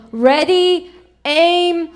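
firing squad command. Acted. Firing squad about to shoot in joke.

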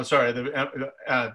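ordinary talk

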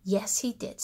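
ordinary delivery, nothing marked

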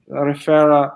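ordinary talk

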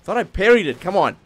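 loud, high cry of frustration; frustrated protest. Real, not acted. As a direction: complain loudly at the game. Just got hit in game.

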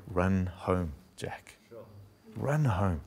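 soft call. Acted. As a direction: speak it softly as God's call. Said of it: "God is saying to you".

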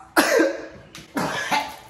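high rough unvoiced cough; harsh coughing fit. Real, not acted. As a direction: cough hard, choking on the burn. Coughing after swallowing wasabi.

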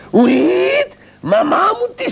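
very high squeaky cry of alarm; high startled puppet yelp; startled squeal. Acted. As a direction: shriek in sudden fright.

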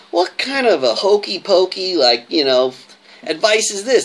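high, slow, disbelieving mock question; retold mocking disbelief, lightly done; incredulous scoff. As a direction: scoff at the advice like it's nonsense. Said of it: "I didn't get it".

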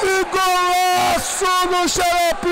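excited shouted goal call. Real, not acted. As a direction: yell it as the goal goes in. Goal scored, commentator shouts.

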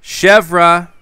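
loud call across the island. Real, not acted. Calling out to find the missing villager. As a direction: call her name out loud.

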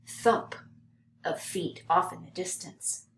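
usual speaking voice

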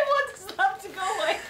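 laughing. Real, not acted. Laughing fit mid sentence.

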